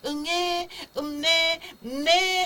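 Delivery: high, drawn-out lip-smacking wail; hungry baby cry. Shown as a demonstration. Teacher demonstrates a hungry baby's cry, high mock wail. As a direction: imitate a hungry newborn's "nneh". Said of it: "입맛 다시는 입술 모양으로".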